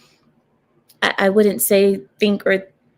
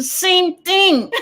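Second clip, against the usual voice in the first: laughing; 9 semitones higher than usual. Real, not acted. Amused at her own point.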